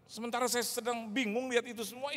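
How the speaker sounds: normal talking voice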